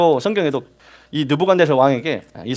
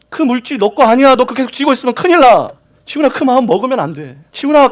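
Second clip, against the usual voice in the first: rising, louder pleading rebuke to a straying believer; God's pleading warning; 7 semitones higher, 4 dB louder than usual. Acted. God warning Jihun, a rising pleading rebuke. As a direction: plead with him, then press harder.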